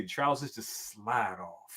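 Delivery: slow, low, soft, playful seduction; mock sexy. Guest teases host about trousers sliding off, mock sexy. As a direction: draw it out quietly, teasingly suggestive. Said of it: "That sound kinda sexy".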